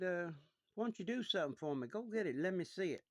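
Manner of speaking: father urging his son to fetch the doll; lightly voiced father. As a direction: ask eagerly, hurrying the boy along.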